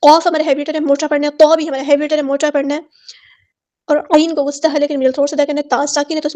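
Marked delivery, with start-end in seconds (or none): none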